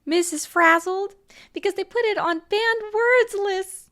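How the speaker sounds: hammed-up gentle-parenting tone, like scolding a toddler; syrupy mock patience aimed at the critic; mock condescending sweet voice. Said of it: "this is a very condescending way to talk".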